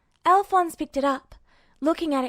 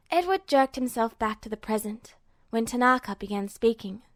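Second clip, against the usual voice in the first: ordinary delivery, nothing marked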